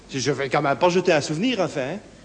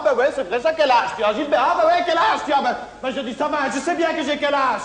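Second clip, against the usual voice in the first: much higher put-on voice, teasing; high mocking voice; 9 semitones higher than usual. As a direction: squeak the questions like a taunting kid. Acted banter about Gilbert's age.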